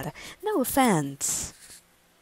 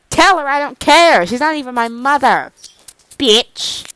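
loud bratty retort; a loud, bratty snap; defiant snap; 4 semitones higher, 9 dB louder than usual. Acted. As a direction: shoot back with defiance.

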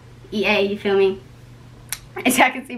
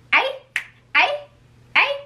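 short high calls, spaced apart; high signature ad-lib; high-pitched catchphrase; 13 semitones higher than usual. Real, not acted. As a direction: pop out each call up high, then pause. Repeated catchphrase before the intro.

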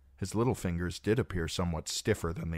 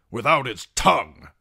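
loud, raised, vindictive addition; angry condemnation; 7 semitones higher, 11 dB louder than usual. A narrator's reading. Knight adds to the condemnation, loud and angry. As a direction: add it forcefully, with venom.

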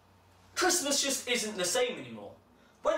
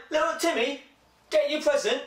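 mum voice; 4 semitones higher, 4 dB louder than usual. Acted.

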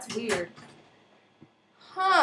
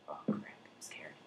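scared whisper; 16 dB quieter than usual. Real, not acted. Scared alone in a cave.

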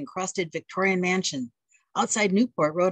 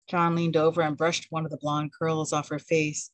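plain reading voice

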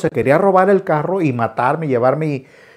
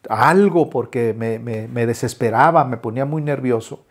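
normal talking voice

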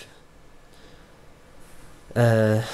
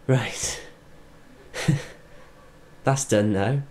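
ordinary talk, nothing marked

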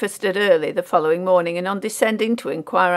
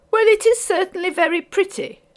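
very high-pitched mother's voice; critical lady; 12 semitones higher, 4 dB louder than usual. A narrator's reading. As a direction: appraise it critically in a high ladylike voice. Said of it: "observed the lady critically".

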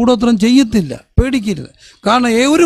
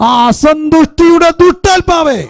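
high, louder commanding call to the spirit; rebuking cry; 6 semitones higher, 3 dB louder than usual. Real, not acted. Preacher casting out the spirit of discontent, high and loud. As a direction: rebuke the spirit with full force.

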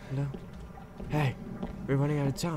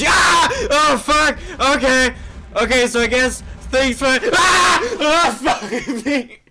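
loud, high yells of panic; startled screaming; 11 semitones higher, 11 dB louder, slower than usual. Real, not acted. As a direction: keep crying out as the scares hit. Repeated jump scare.

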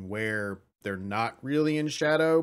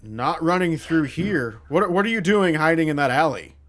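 loud, higher demanding cultist; accusing, raised-voice interrogation; stern challenge; 4 semitones higher, 7 dB louder than usual. Acted. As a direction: confront someone caught hiding. Cultist challenges the disguised player.

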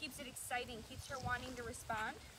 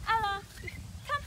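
loud, high excited recall call; 7 semitones higher, 8 dB louder than usual. Shown as a demonstration. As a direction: call the dog in, high and eager. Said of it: "Using an excited tone of voice".

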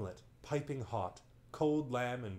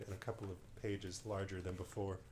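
usual speaking voice

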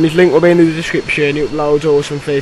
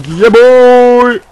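loud high whoop of triumph; celebratory yell; 8 semitones higher, 11 dB louder than usual. Real, not acted. Gamer yelling at his own lucky kill, loud. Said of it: "I'm like".

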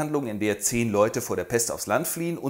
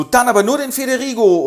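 insistent widow; 10 semitones higher, 7 dB louder than usual. Acted.

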